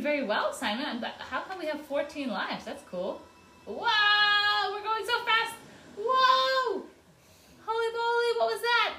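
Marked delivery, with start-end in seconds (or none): 3.7-8.9 high, loud thrilled squeals; sledding downhill. Real, not acted. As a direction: shriek with delight going fast. We're going downhill.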